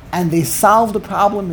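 normal talking voice

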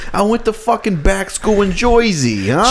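high mock Jersey tough guy; hammed-up Jersey accent. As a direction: boast in a put-on Jersey accent. Plays back-school graduate from Jersey.

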